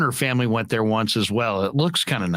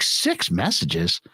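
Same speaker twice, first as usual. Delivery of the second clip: low, slow disbelieving "Six messages"; incredulous; 8 semitones lower, much slower than usual. Retold or imitated. Teller reacting to his full machine, low incredulous echo. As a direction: repeat "Six messages" in dropped-pitch disbelief. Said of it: "And I'm like".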